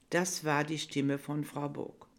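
usual speaking voice

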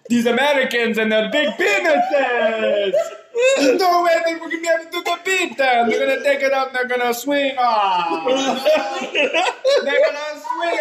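high, loud, wailing fake Russian accent with laughter; mock Russian accent. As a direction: ham up a despairing Russian general. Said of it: "That's probably not even the right accent".

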